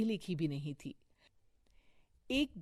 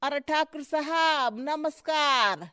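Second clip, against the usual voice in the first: high, loud hail with drawn-out ending; hearty greeting; 7 semitones higher, 11 dB louder, slower than usual. Acted. Visitor greeting Thakur at his house, hearty. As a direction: call out an effusive hello.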